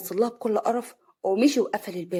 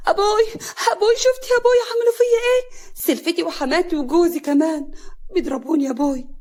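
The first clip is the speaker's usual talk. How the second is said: high, loud crying wail; tearful; 8 semitones higher, 6 dB louder than usual. A narrator's reading. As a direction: sob out a complaint to father. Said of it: "هنا بدموع". (ببكاء ودموع)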